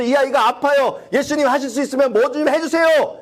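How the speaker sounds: high, simple trusting plea; just hand it over to Jesus; humble plea. Pretend. (높은 목소리로 소박하게 맡기는 간구)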